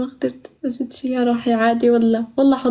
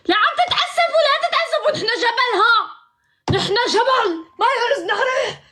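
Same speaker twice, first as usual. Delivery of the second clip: high, playful mock Levantine dialect with laughs; mock Levantine accent; 9 semitones higher than usual. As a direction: tease them in a put-on Levantine voice. (لهجة شامية ساخرة بصوت عالٍ)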